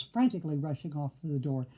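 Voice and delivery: usual speaking voice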